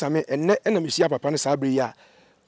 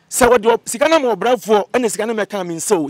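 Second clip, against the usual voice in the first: louder, higher, pressing each point; loud insistence; 5 semitones higher, 7 dB louder than usual. Real, not acted. Insisting to the host the money should reach him, loud. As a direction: push it out, raised and emphatic. Upset telling of lost money.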